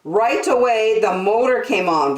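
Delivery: put-on lively read-aloud for little kids; storybook narration. A narrator's reading. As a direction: tell it like a children's storyteller. Reading picture book aloud.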